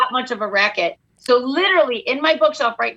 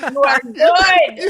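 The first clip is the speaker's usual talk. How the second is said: mock pep-talk shout; 3 semitones higher, 4 dB louder, much slower than usual. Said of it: "You're like".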